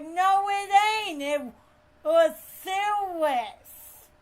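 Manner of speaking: high, loud mock whine; indignant protest. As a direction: protest loudly, stretching the last word. Retold friend's angry protest.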